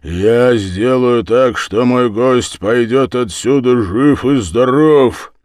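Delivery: loud, high, emphatic declaration; angry vow. Acted. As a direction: vow it at full voice. Host's angry vow in a quarrel.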